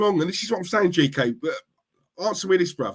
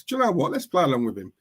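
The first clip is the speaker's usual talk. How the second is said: ordinary talk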